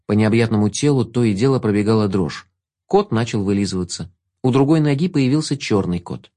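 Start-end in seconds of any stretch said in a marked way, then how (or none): none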